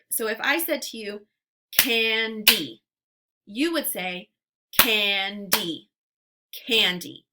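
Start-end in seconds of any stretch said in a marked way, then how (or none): 1.7-2.8 candy in two parts, "can... dee"; louder candy with a gap between syllables; split into syllables. Shown as a demonstration. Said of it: "give you two parts to a word". 4.7-5.9 candy as "can... dee", gap between; candy slowly, syllable by syllable; split into syllables. Shown as a demonstration. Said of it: "give you two parts to a word".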